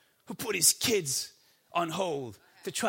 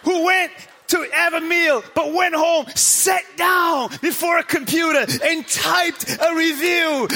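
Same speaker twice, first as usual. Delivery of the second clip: loud, high, hammering preacher build; preaching at full force; 8 semitones higher, 5 dB louder than usual. Acted. Pastor preaching hard to the church about reviewers, loud. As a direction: drive it home to the congregation, full throat.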